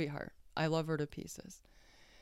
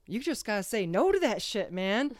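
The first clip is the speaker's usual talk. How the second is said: loud, higher-pitched mock exhortation; emphatic imitated advice; 6 semitones higher, 8 dB louder than usual. Friend urging her to say no, emphatic and loud. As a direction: imitate a friend insisting, raised and punchy.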